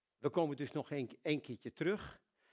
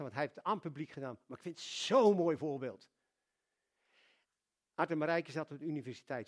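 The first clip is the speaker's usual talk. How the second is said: ordinary talk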